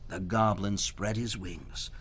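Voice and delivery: ordinary delivery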